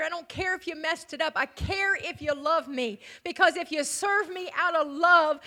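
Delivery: usual speaking voice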